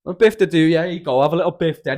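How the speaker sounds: loud teasing call; mock teasing. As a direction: jeer at the sleeping mate.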